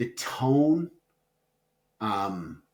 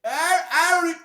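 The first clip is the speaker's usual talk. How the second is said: loud, high-pitched fake wail; mock distraught; 5 dB louder than usual. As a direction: stammer it out in hammed-up dismay. Playfully disowns co-host over ranking.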